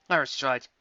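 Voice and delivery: ordinary delivery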